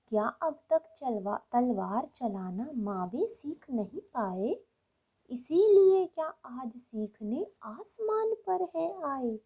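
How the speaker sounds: put-on lilting, swooping child's voice with emphatic peaks; little child reciting. Small child asking mother about thunder and lightning, childlike recitation. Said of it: "जैसे कि एक छोटा बच्चा बोल रहा हो".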